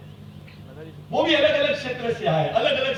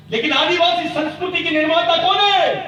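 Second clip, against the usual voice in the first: booming rousing general speech; 5 semitones higher, 6 dB louder than usual. Real, not acted. Rhetorical question to crowd.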